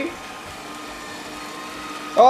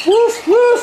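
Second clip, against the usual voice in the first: dog barking; 13 semitones higher, 4 dB louder than usual. Pretend. Playing a dog for the cat.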